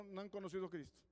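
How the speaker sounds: ordinary talk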